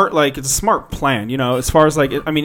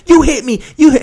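high, loud mock accusation; 10 semitones higher, 9 dB louder than usual. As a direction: imitate an outraged passenger, pitched way up.

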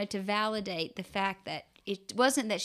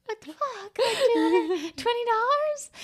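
much higher, stunned squeak; shocked disbelief; 12 semitones higher, slower than usual. Retold or imitated. Mother to husband, shocked at the cash. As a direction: gasp it out in disbelief.

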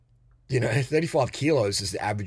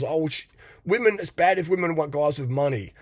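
mocking complainer; 6 semitones higher, 4 dB louder than usual. Mocks what guys say.

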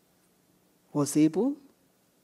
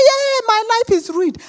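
distressed caller; 8 semitones higher than usual. Retold or imitated.

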